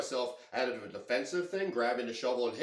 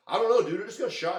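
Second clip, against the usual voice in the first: agitated protest; 8 semitones higher, 8 dB louder than usual. Retold or imitated. Agitated reply after being shot.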